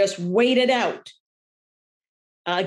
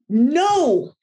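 raised, higher no of a frustrated owner; irritated; 5 semitones higher, 4 dB louder than usual. Shown as a demonstration. Owner escalating with the puppy, irritated. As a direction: let the tone climb with frustration. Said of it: "And we get irritated".

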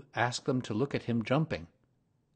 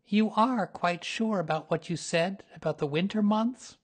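lightly voiced bear of little brain, higher; Pooh voice; 10 semitones higher, 4 dB louder than usual. A narrator's reading. Pooh asks Rabbit about winter months, lightly voiced.

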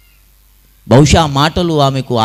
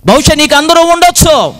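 high, raised sermon voice; fervent preaching cry; 14 semitones higher, 5 dB louder than usual. Real, not acted. Preacher tells listeners they may have everyone, high and fervent. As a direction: cry it out to the back rows.

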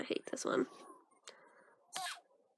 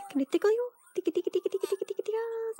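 high-pitched, rising tickle chatter; tickle-tickle in a high teasing lilt; playful tickle talk; 8 semitones higher, faster than usual. Pretend. Tickling a game character playfully.

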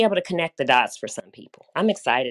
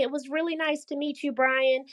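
ordinary delivery

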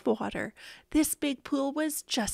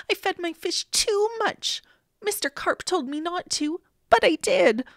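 higher, mildly panicked confession; urgent confession; 6 semitones higher than usual. A narrator's reading. Boy phones policeman about his fish, mildly panicked. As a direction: blurt it out, voice raised in pitch.

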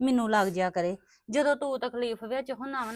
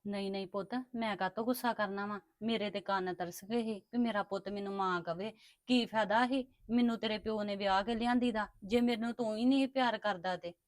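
tender; 4 semitones lower, 6 dB quieter than usual. Acted. Stepmother tenderly answers her son.

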